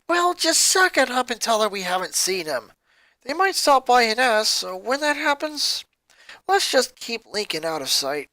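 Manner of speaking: put-on a worried tomboy pressing her boyfriend; voiced teen girl. A narrator's reading. Ronnie Anne voiced again.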